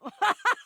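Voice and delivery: high burst of laughter; mocking laugh. Hearing Jacques's silly sword name, laughing hard. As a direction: crack up helplessly.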